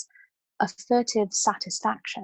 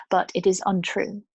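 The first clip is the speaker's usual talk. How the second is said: ordinary delivery, nothing marked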